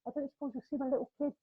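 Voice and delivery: high, quick mock southern English voice; southern English accent. Southerner asking after lost kid, mock southern English accent. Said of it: "you can hear that voice".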